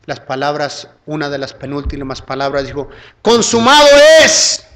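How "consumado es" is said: slow, high, loud proclamation; triumphant cry. Acted. Christ on the cross declaring his work finished, triumphant cry. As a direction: cry it out with each syllable drawn long.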